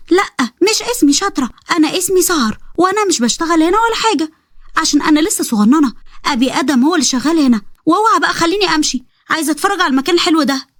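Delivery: put-on high childish pleading voice; little girl voice. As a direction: sound like an eager little girl asking her brother.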